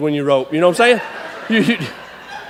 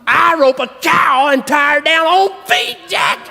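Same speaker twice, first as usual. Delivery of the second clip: high, drawn-out hammed-up brag; cocky boast; 6 semitones higher, slower than usual. Roper bragging he outdid rodeo stars, cocky boast. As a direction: crow about it, stretching the words.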